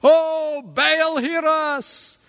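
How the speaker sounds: hammed-up cry to a false god; pagan prophets crying out. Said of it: "they began to cry to Baal".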